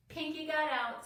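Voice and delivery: high, loud warning; urgent call. A narrator's reading. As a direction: call it out, alarmed.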